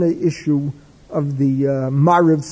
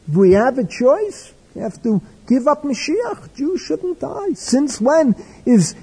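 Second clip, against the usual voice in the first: much higher, indignant and pleading, slowing on key words; impassioned rebuke; 6 semitones higher than usual. Retold or imitated. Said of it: "Reb Chaim becomes hysterical".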